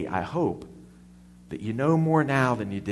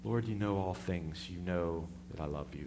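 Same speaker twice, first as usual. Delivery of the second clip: much softer, pained answer; hurt, humble reply; 8 semitones lower, 13 dB quieter than usual. Acted. Peter answers Jesus the third time, hushed and hurt. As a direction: say it quietly, a little wounded. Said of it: "he says".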